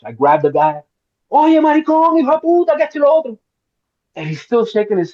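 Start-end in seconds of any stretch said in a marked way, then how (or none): 1.3-3.4 high-pitched, rattled-off Spanish cursing; high, hammed-up Spanish insults; mock Spanish tirade. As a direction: retell an audition outburst, pitched way up. Acting the audition scene in Spanish.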